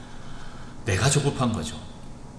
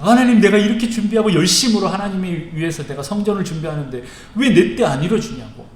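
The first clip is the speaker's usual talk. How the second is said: higher, louder indignant protest; angry complaint; 7 semitones higher, 6 dB louder than usual. Acted. Preacher imagines himself protesting to God, angry complaint. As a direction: demand an answer from God, aggrieved. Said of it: "그럼 저는 막 화가 났을 것 같아요".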